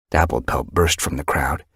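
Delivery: usual speaking voice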